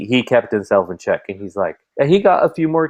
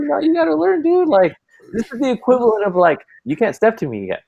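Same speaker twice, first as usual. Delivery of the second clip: mock taunting; 12 semitones higher than usual. Retelling own taunt to son.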